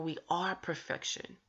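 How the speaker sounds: normal talking voice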